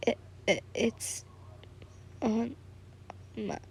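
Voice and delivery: barely audible stammer; mumbling. A narrator's reading. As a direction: trail into near silence, stammering. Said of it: "mumbled something that you didn't hear".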